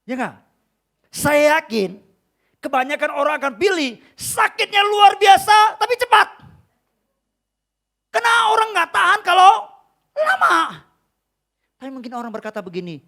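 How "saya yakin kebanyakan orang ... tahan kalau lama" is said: shouted, high-pitched sermon climax; very loud, emphatic exhortation; full-force preaching. Acted. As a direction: build to a pulpit shout.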